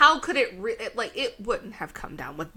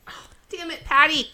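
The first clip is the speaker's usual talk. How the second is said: exasperated shout; 8 semitones higher, 10 dB louder than usual. Real, not acted. Scolding the dog.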